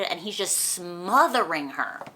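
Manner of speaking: ordinary talk, nothing marked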